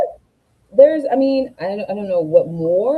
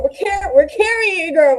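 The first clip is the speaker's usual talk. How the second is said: excited; 7 semitones higher, 4 dB louder than usual. Real, not acted. Hyping up a friend.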